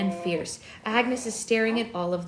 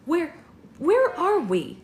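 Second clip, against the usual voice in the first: bewildered; 7 semitones higher, 6 dB louder, slower than usual. Acted. Bella wakes up bewildered.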